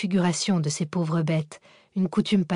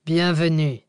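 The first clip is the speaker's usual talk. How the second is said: underworld god; 4 dB louder than usual. A narrator's reading. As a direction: sound like a voice from the grave. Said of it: "fit une voix d'outre-tombe".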